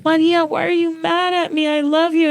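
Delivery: high whiny plea; hurt pleading. Retold or imitated. Asking her dead Nana why she is mad, high and pleading.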